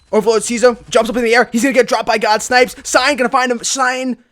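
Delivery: loud, high esports caster call; live play-by-play. Real, not acted. Caster calling a firefight for viewers, excited play-by-play. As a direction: call the action with rising urgency.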